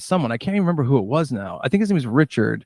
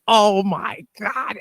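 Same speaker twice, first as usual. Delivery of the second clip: slow, high, stunned oh my God; drawn out in disbelief, pitched up; shocked; 8 semitones higher, much slower than usual. Retold or imitated. Kevin seeing the review he forgot, stunned and slow.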